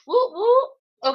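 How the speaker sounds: high, loud whoop whoop; excited cheer. Real, not acted. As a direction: celebrate like you won. Celebrating correct answer.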